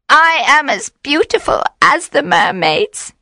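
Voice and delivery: high coaxing witch voice; tempting witch. A narrator's reading. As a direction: lure him with your beauty. Witch tries to seduce him.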